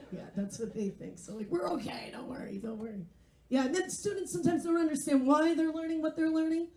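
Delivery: ordinary delivery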